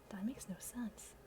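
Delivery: breathy whisper of disbelief; whispered aside. Real, not acted. Reading her old story, whispering to herself. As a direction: mutter it under your breath.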